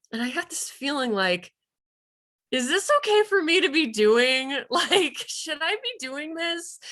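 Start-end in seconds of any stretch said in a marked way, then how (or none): 2.5-6.8 nervous doubt. Retold or imitated. Nervous inner voice, laughing.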